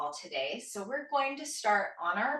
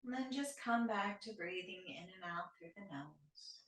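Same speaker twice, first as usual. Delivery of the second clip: soft, unhurried yoga nidra guidance; calm, quieter meditation teacher voice; guided meditation; 2 semitones lower, 6 dB quieter than usual. Shown as a demonstration. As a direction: ease listeners into stillness, with long pauses between phrases. Leads guided breathing meditation.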